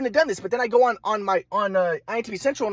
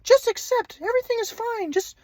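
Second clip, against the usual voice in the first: mock grandpa voice; 14 semitones higher than usual. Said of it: "My grandpa was just like".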